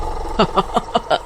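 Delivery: breathy unvoiced witch snicker; a creepy hiss of a laugh; soft airless witch laugh. Witch appears behind Lallu.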